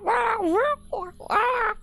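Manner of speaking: piercingly high cartoon mouse squeal; goofy mouse impression, sky-high; Mickey Mouse. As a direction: swear in a silly squeaky falsetto. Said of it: "supposed to be Mickey Mouse".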